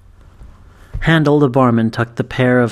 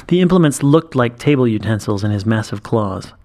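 usual speaking voice

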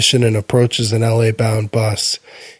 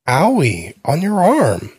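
put-on little boy; 5 semitones higher than usual. Little boy points at wound.

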